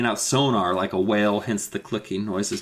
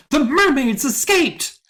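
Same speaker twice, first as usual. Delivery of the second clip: high, loud, drawn-out announcement; mock alarmed shout; 14 semitones higher, 5 dB louder, slower than usual. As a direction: burst in yelling the news, hammed-up. Acted character bursts in announcing escape.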